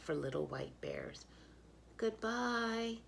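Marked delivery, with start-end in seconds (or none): 2.0-3.0 sad goodbye. Acted. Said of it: "he says sadly".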